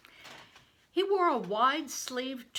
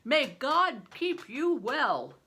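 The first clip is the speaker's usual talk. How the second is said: higher, louder, slow booming blessing; a giant of a man, booming and slow; big stranger; 6 semitones higher, 9 dB louder, slower than usual. A narrator's reading. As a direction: play the huge man, loud and hearty.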